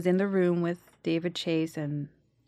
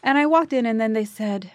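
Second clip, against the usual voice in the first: usual speaking voice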